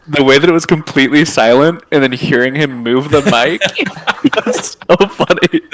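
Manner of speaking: loud laughing, high; laughing hard, drawing out so funny. Real, not acted. Cracking up at mic noise.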